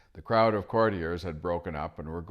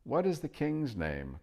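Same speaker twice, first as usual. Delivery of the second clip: cry out; 8 semitones higher than usual. Acted. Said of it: "cried Eustace".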